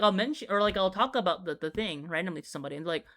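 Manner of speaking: usual speaking voice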